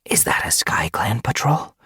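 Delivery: hushed whispered question. Acted. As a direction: whisper so the patrol won't hear. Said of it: "Duskfur whispered".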